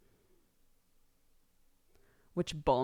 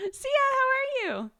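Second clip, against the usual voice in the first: high, loud delighted call; excited greeting; 6 dB louder than usual. Real, not acted. Streamer greeting a viewer, high and excited. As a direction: sing out a hello, pitched way up.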